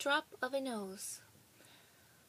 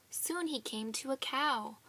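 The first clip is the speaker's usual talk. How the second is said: ordinary delivery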